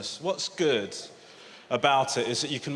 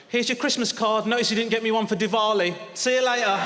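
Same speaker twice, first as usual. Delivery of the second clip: high, singsong doorstep greeting with a jibe; pointed mock cheer; 7 semitones higher than usual. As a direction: needle the neighbour in a high, chirpy voice. Acted doorstep jibe at a neighbour.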